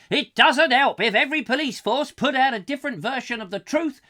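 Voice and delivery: loud, high, hammed-up tirade; read-out rant. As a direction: read it as an indignant ranter in full flow. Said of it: "continues with his rant".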